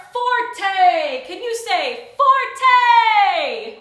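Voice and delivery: "forte" said loud and high, drawn out. Shown as a demonstration. Teacher showing the children loud by saying "forte" loudly. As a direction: belt out "forte" to show loud. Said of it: "Forte means loud".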